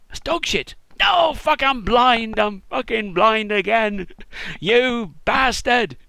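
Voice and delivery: loud, high, slow outraged yell; furious shout. Pretend. Blind man realising it's dog shit, furious yelling. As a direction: bellow in betrayed rage. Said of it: "blind guy ... going".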